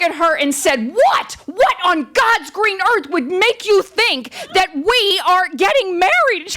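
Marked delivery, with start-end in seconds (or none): 0.9-6.4 high, hammed-up outraged disbelief; livid outburst. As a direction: demand an answer in slow, high-pitched mock fury. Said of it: "I am livid".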